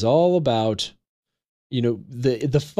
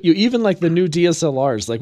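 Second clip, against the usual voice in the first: usual speaking voice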